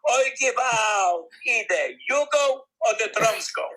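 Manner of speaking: wife shouting either you go or the drums go; wife yells. Retold or imitated. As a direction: yell the ultimatum at full volume. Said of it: "She yells".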